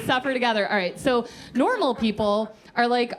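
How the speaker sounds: normal talking voice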